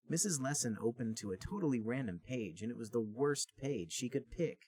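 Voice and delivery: ordinary talk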